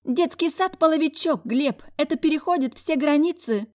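high, loud petulant wife; whiny ex-wife. A narrator's reading. Ex-wife on phone.

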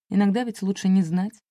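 plain reading voice

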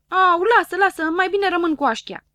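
put-on high, loud complaining little boy; whiny Dunno. Dunno whining to doctor Pilulă that it hurts, high and loud. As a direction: whine at the doctor who lied.

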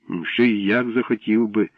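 ordinary delivery

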